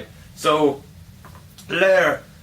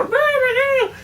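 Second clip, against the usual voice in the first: very high squeaky rah rah; tiny boomerangs' battle cry; 4 dB louder than usual. Acted. Tiny boomerangs fighting the big one, very high squeaks. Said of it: "the tiny ones were".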